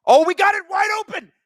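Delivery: high, loud cry at a big chance; excited commentator shout. Real, not acted. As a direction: shout it like a scoring chance just opened. Play-by-play of a near goal.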